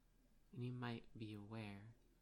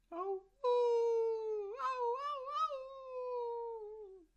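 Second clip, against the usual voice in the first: wolf howl; 16 dB louder, much slower than usual. Acted. Acting the reply howl.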